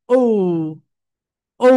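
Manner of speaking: "ও" held as long as drawing a half circle; long rounded O. Shown as a demonstration. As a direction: stretch "ও" out in one smooth rounded sound. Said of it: "half circle আঁকতে যত সময় লাগে". (টেনে লম্বা "ও")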